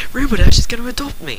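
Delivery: high, loud burst of joy; bursting excitement. A narrator's reading. Scootaloo telling her friends the big news, bursting with excitement. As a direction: blurt it out, thrilled. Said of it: "could hardly contain her excitement as she burst out".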